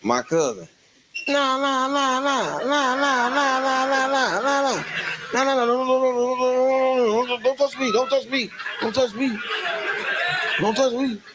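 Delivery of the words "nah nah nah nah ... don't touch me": rapid high nah nah, waving off a friend; high drunk refusal. Retold or imitated. Drunk cousin to the friend holding him back, high frantic refusal.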